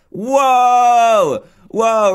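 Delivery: long, high, loud whoa; big drawn-out exclamation; amazed whoa. Real, not acted. Reacts to surprise success.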